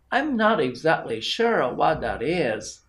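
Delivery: ordinary delivery, nothing marked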